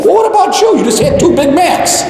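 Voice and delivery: high-pitched mock challenge to the guru; comic high voice of protest; incredulous follower. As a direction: squeak it out as an indignant disciple calling out hypocrisy. Acts follower challenging overweight Buddha.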